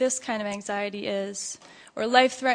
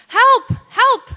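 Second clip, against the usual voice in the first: high, loud cries for help; storybook scream of panic; 12 semitones higher, 13 dB louder than usual. A narrator's reading. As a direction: scream it in two short bursts. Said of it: "Chicken Little screamed".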